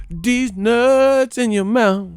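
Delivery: high, slow drawn-out taunt; sing-song the insult slowly; mocking taunt.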